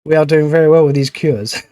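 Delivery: usual speaking voice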